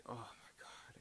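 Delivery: horrified, breathy whisper; hushed horror. Real, not acted. Reviewer recalling the screaming girl in the well, hushed horror. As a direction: whisper it under your breath, appalled.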